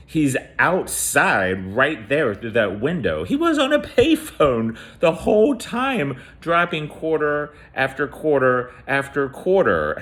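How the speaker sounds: high-pitched, incredulous retelling; alarmed revelation. Retold or imitated. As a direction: build to a high, astonished peak. Retelling the shocking reveal of caller.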